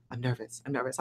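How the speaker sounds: mimicking nervous speakers, soft and low. Imitating jittery backstage speakers, soft nervous murmur. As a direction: shrink into a small worried murmur. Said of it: "All the speakers like".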